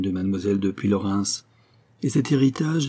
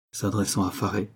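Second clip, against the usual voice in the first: usual speaking voice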